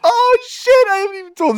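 high squeal of laughter breaking through the words; can barely get it out for laughing; cracking up. Real, not acted. Laughing at his own story, high and helpless. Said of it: "I'm fucking gonna start crying laughing".

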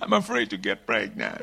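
fearful confession. Retold or imitated. Bride voiced confessing fear.

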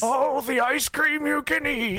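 put-on high, slow, quavering old man. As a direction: croak it like a doddering old doctor. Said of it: "stereotype old man voice".

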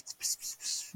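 soft whispered nonsense syllables; whispered gibberish in place of words; mock whispering. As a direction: slip into pretend whispering mid-sentence. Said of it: "That's what he's whispering".

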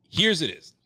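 mock grand announcement; announcing. Shown as a demonstration. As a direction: call it out to the room. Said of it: "repeats line".

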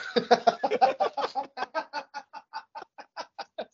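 a long, high burst of real laughter; helpless giggling fit. Real, not acted. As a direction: crack up at the joke. Co-host cracks up at joke.

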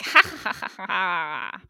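imitated wicked laughter; mock evil laugh. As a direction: cackle like a villain, drawing out the last ha. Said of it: "an evil, like".

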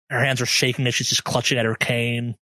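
ordinary delivery

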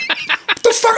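high, startled what the fuck; shocked outburst. Retold or imitated. Father shocked in the shower, high startled yelp. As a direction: yelp it in disbelief.

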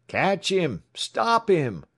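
high, loud pursuer's shout; urgent high-pitched cry. A narrator's reading. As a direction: yell after a fleeing man. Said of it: "shouted the pursuers".

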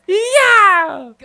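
high, drawn-out celebratory yell; mimicked victory yell. Commentator mimicking the player who celebrated too early, high drawn-out yell. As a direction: imitate a pumped player's long scream.

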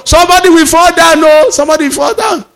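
alarmed shout. Retold or imitated. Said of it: "they started banging the door".

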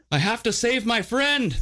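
high, laughing bum voice; high-pitched naked man, giggling; earnest bum. Retold or imitated. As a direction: play the bum's earnest line through a laugh. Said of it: "And he goes".

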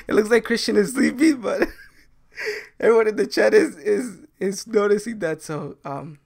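high, loud, giggling through words; barely holding back laughter; stifled giggles. Real, not acted. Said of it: "I'm trying to keep a straight face here".

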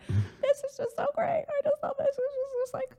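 very high, crying falsetto; mock sobbing. Recalling weeping at the English farm, high crying voice. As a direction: squeak it out through happy tears. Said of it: "like, sobbing".

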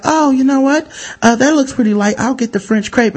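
a hint of a chirpy diner ordering; lightly voiced customer.

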